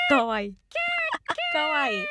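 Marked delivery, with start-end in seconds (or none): 1.5-2.0 high squeal of delight; squealing cute. Real, not acted. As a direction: gush over something cute. Cute owl transformation.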